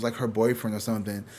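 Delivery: ordinary talk, nothing marked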